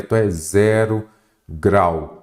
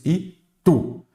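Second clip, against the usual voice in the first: "Itu" pulled apart as I, TU; high "I", pause, then "tu"; split into syllables; 8 semitones higher than usual. Shown as a demonstration. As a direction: sound out "Itu" to show the final stressed syllable. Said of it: "Qual que é a sílaba tônica?".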